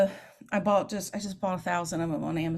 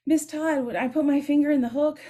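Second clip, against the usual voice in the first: put-on high, louder kid's question; imitating a student; 8 semitones higher, 6 dB louder than usual. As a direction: play the eager sixth grader asking. Student asking teacher.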